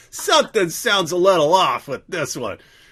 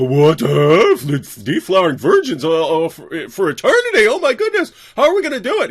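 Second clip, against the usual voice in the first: loud, high, incredulous squawk; hammed-up gasping disbelief, rising higher; mock shocked followers; 5 semitones higher, 8 dB louder than usual. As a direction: play the followers, stunned and shrieking. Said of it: "And they go".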